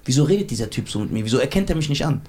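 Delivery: low, mildly wounded complaint; understated plaintive imitation, pitched low; imitated whining. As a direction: retell a hurt friend's lament. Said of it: "so geheult hab ich jetzt auch nicht".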